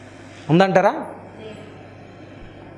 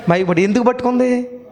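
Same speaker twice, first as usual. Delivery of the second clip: ordinary delivery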